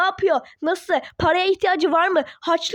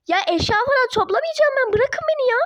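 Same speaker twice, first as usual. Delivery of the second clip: angry refusal; 6 semitones higher than usual. Acted. Daughter refusing her parents angrily. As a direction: shake them off angrily.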